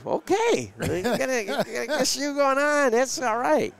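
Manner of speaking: high-pitched, playful put-on voice; mock enthusiastic reaction. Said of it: "I'm like".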